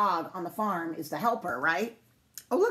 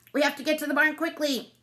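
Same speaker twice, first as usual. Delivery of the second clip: high, brisk duck voice; high, even urgent call; quacking duck; 7 semitones higher, 3 dB louder than usual. A narrator's reading. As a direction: urge everyone along like an anxious duck. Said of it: "Duck quacked".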